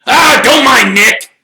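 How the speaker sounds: loud, high jovial holler; shouting Sir Patrick. A narrator's reading. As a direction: shout it breezily at full volume. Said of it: "shouted Sir Patrick's head".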